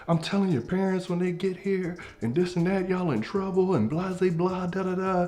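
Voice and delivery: high-pitched mock scolding; scolding teacher. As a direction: rattle on like a teacher, blah-blah. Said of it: "she's yelling at us".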